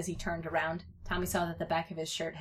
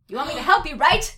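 high, loud demand; pushy kid, raised voice; insistent; 8 semitones higher, 13 dB louder than usual. A narrator's reading. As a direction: press your friend, rising on the last word. Insistent child pressing his friend.

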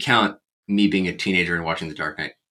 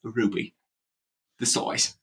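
Michael Caine accent; 9 dB quieter than usual. Shown as a demonstration.

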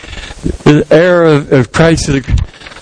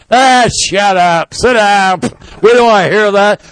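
dismissive heckle; 3 semitones higher than usual. Acted. Crowd heckling the preacher.

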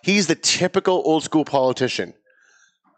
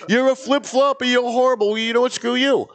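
mocking outrage; 9 semitones higher than usual. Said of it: "everybody was expecting me".

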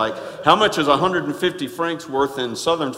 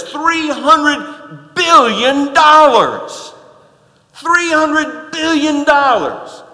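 much higher, louder and slow; amazed emphasis; 9 semitones higher, 8 dB louder, much slower than usual. Real, not acted. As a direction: let the huge number land. Preacher stresses huge sum.

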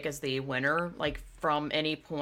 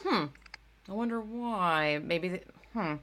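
thinking hmm; 4 dB quieter, slower than usual. Real, not acted. Wondering aloud why others didn't enjoy it.

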